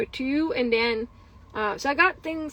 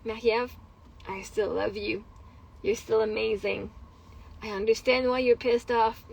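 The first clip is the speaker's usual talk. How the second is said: soft, low, soothing self-talk; tender self-reassurance; 4 semitones lower, 4 dB quieter than usual. Retold or imitated. Woman comforting herself in her anger, tender self-reassurance. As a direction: reassure yourself gently, with pauses between thoughts. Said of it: "even in my anger".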